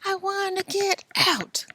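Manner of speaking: high, whispered wailing; a faint cry from behind a door; whispered plea. Acted. Billy pleading behind the door, whispered.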